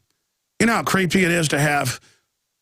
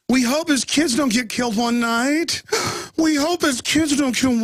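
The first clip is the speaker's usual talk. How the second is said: mock quote, higher; 8 semitones higher than usual. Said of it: "the part about".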